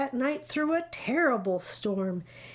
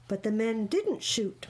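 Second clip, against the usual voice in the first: ordinary delivery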